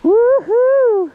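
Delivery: high, loud joyful woohoo; gleeful whoop. Real, not acted. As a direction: let out a big thrill cheer. Sliding down the dune.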